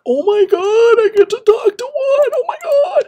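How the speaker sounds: very high, loud excited gushing; excited squeal. Retold or imitated. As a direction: squeal with fangirl delight. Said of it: "And it was like".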